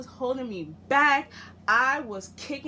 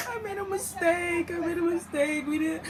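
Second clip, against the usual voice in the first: high, hammed-up whiny begging; mock crying plea; 7 semitones higher than usual. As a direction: imitate him whimpering an apology. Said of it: "on his knees begging, crying".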